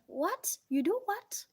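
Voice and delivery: incredulous friend, rising sharply; mock shock. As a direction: pitch jumping high on the second What. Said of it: "He's like".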